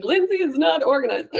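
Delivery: half-joking imitation of a jab; mock accusation. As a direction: playfully voice the jab as if it were meant. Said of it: "a comment saying".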